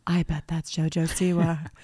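unvoiced excited whisper. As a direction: hush it like a secret sighting. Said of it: "And then I went".

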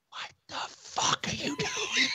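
high, harsh stage whisper; wife catching husband sneaking at dawn, whispering furiously; whispered hiss. Retold or imitated. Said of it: "my wife goes".

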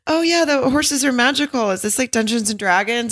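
high, bright, eager question; high bubbly girl voice. Retold or imitated. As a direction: play the excited girlfriend. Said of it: "she's like".

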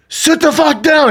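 a sudden high, slow mock command; mock yell. Telling the giant in front to sit down, a high mock yell. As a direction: bark it high and slow like a tough guy. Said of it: "I'm like".

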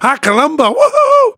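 high, loud cartoonish Mexican greeting; mock Hondro's "American" accent as a cartoon; hammed-up high woohoo; Speedy Gonzalez voice. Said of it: "You sound like Speedy Gonzalez".

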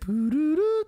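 high-pitched mock dial-error beeps; imitated phone tone. Calling her number a second time, imitated phone tone. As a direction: voice the rising error tone.

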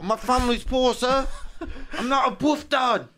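high pleading Cockney beggar voice; Cockney street urchin. Retold or imitated. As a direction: whine like a poor London street kid. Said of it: "more of a street".

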